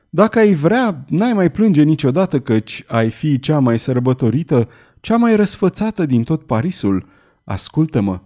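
ordinary delivery, nothing marked

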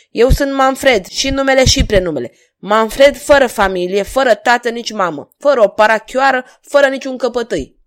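loud, high proclamation; harsh. A narrator's reading. Stranger naming himself to the king, harsh defiance. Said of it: "replică vocea aspră a necunoscutului".

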